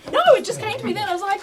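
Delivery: high, loud, giddy self-defence; excited protest. Real, not acted. Defending a pun amid laughter.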